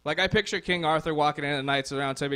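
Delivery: usual speaking voice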